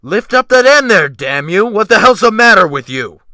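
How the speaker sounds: furious shout. A narrator's reading. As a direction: bellow at the men in full rage. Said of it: "He burst upon them in a fury".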